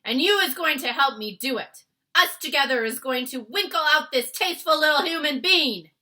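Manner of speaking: loud high bellow; shouting. Acted. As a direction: roar the order. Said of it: "he shouted".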